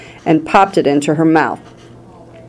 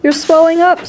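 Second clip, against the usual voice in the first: high-pitched, contained scream; 12 semitones higher than usual. A narrator's reading. Mother sees daughter swell, high alarmed scream. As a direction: scream it high without much volume. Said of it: "screamed Missus Beauregard again".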